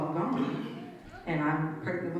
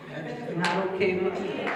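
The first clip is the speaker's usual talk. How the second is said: louder, raised pitch, firmly declaring; a firm, raised declaration of refusal; defiant vow; 4 semitones higher, 6 dB louder than usual. Retold or imitated. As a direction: put your foot down out loud. Vowing to herself after escaping.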